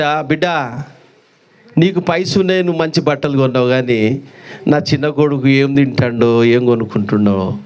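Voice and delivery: low and sorrowful, worrying for the lost son; old mother telling her elder son of her fears for the younger, low and mournful; grieving old mother. Acted. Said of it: "అని ఏడుస్తుందట".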